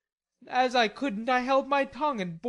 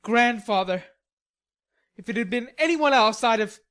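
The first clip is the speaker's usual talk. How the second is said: put-on loud, heated young man's voice; angry outburst; 4 semitones higher, 7 dB louder than usual. Laurie raging about his grandfather, loud and angry. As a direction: spit it out furiously.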